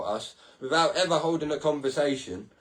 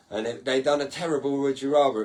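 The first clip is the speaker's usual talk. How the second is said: normal talking voice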